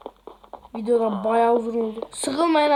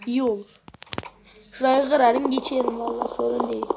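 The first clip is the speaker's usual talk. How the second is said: usual speaking voice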